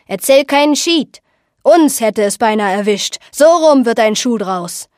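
loud, indignant retort; irritated skipper setting things straight; annoyed skipper. Acted. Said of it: "Der Skipper stemmte ärgerlich die Hände".

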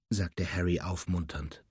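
ordinary delivery, nothing marked

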